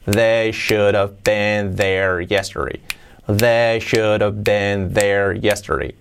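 slow, even, low linked phrase; practice model. Shown as a demonstration. Teacher leads learners in practice, slow and even. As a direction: stretch it out evenly for repeating.

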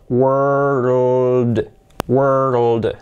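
WORLD stretched very slowly, each sound sliding into the next; exaggeratedly slow WORLD; slow-motion pronunciation. Shown as a demonstration. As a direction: draw WORLD right out through R, L and D. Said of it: "Hacha despacio".

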